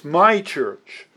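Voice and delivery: "my" said stronger, with a falling tune; stress on MY. Shown as a demonstration. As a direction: lean on the word "my". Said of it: "and I emphasize this".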